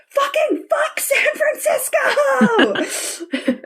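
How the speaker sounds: high-pitched, strained rallying cry; hammed-up scream to fire up the team; mock battle cry. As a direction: shriek it like an imitated locker-room war cry. Acting out a pregame speech.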